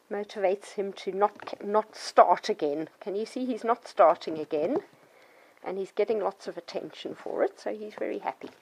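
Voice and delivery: ordinary talk, nothing marked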